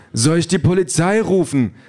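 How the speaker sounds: high-pitched urgent offer of help; alarmed Ken. A narrator's reading. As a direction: ask urgently in a high, calling voice. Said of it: "sagt Ken".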